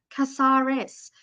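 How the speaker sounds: "Casares" sounded out slowly, syllable by syllable; careful name try. Shown as a demonstration. Unsure reader tries a viewer's surname, slow and careful. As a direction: drag out "Casares" carefully as kah-SAH-res. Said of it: "how you say it".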